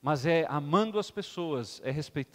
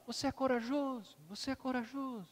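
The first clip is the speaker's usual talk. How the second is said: softer and softer repeats; echo; 6 semitones higher, 10 dB quieter than usual. Acted. The echo returns the father's words, fading echo. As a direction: let it die away. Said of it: "o eco".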